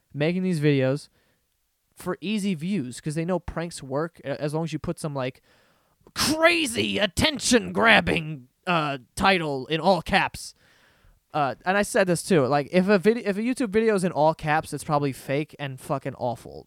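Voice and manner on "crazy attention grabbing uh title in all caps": mocking emphasis. Mocking clickbait titles.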